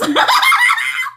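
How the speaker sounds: a real, loud, high laugh; shrieking with laughter. Real, not acted. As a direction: whoop with laughter. Laughing shout.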